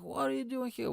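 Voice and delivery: high, startled question; surprised. Retold or imitated. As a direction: caught off guard. Guys surprised he came back.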